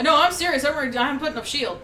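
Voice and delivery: fast, loud protest; urgent. Real, not acted. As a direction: hurry to insist. Urgent protest about spell.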